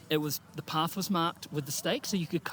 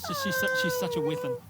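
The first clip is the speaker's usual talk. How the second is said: excited high gush. Real, not acted. Raving about a fellow runner.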